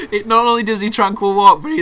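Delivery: can barely get it out for laughing; laughing while talking. Real, not acted. Gamer describing the chaos while laughing loudly.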